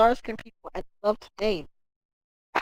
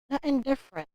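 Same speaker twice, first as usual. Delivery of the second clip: softer, dropped "Nothing different" to himself; quiet repeat; 4 semitones higher, 6 dB quieter, faster than usual. Shown as a demonstration. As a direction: let "Nothing different" fall away under the breath.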